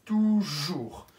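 ordinary delivery

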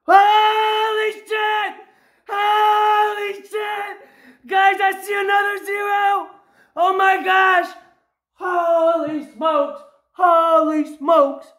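real whooping disbelief, easing slightly at the end; amazed shouting. Real, not acted. Player spots another zero while viewers watch, amazed shouting.

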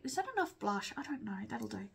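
soft breathy whisper to herself while checking makeup; whispered aside. Real, not acted. Vlogger checking her blush, whispering to herself. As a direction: whisper it under your breath, half to yourself.

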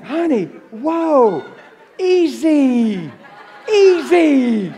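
calm her down while cracking up; laughing whoa easy. Retold or imitated. The preacher calms the swearing grandmother, laughing and slow.

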